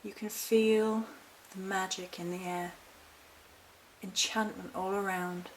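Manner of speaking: softly paced, soothing storytelling, phrase by phrase; guided meditation. Acted. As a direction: gently lead a listener through a calm visualisation.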